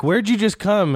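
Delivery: put-on high questioning girl voice; girl's question. Girlfriend asks him high-voiced where he came. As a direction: pitch up, puzzled. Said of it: "and then she's like".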